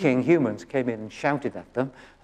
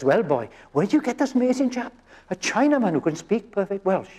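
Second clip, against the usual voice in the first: lightly put-on Welsh lilt for the amazed customer, higher; a customer in a Welsh accent, pitched up and a bit amazed; amused Welsh-accented customer telling a joke; 6 semitones higher than usual. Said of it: "beckons over the owner in Welsh".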